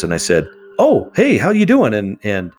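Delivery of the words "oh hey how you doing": high, loud, chipper hello; bright phone greeting. Retold or imitated. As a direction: greet her like an old friend, upbeat. Answering Julie's call cheerfully.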